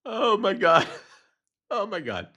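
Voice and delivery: high, giggling through the words; real fading giggles; laughing. Real, not acted. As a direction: still laughing, trying to recover. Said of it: "That was a lot of laughing".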